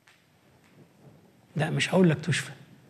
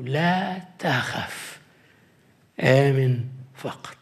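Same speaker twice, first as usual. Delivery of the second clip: slow gentle assurance; 5 semitones lower, much slower than usual. Acted. Quoting Jesus gently.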